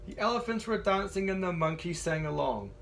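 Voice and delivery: ordinary delivery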